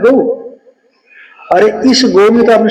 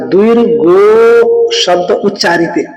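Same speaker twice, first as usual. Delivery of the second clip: द्विर्गो शब्द उच्चारित recited slowly, each word weighed; slow sutra recitation; slower than usual. Shown as a demonstration. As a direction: read out the Sanskrit line slowly and deliberately. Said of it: "इसलिए कहा". (सूत्र का धीमा पाठ)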